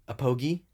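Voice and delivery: "Apogee" as uh-POH-ghee with hard g; "Apogee" as uh-POH-ghee, high and questioning; alternative pronunciation. Shown as a demonstration. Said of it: "I hope I'm saying this right".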